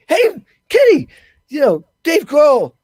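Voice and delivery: high, loud eager call of his name; excited greeting. Retold or imitated. [name] greeting, retold.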